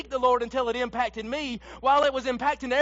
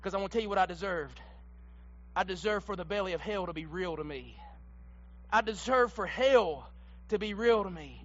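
almost a whisper, heavy with guilt; hushed confession; 7 semitones lower, 9 dB quieter than usual. Acted. Preacher confessing his guilt to the church, hushed. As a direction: drop the voice low and soft.

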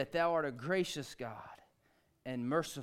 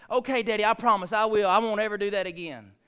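promising daughter; 7 semitones higher, 11 dB louder than usual. Retold or imitated. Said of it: "next day".

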